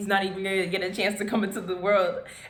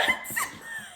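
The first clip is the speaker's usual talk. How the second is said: a shrill, breathy burst of panicked laughter; high hysterical laugh; 5 dB quieter than usual. Retold or imitated. Hysterical reaction to test.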